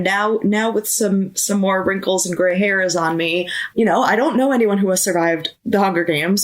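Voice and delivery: ordinary talk, nothing marked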